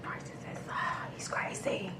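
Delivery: very quiet voiceless whisper; whispered aside. Retold or imitated. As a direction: whisper it under your breath, as a private verdict. Private aside about him.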